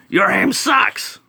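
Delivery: high, slow, shouted cartoon bird; squawky bird shout. Bird alien jeering at the private's aim, slow high shout. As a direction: yell it like a mocking bird creature.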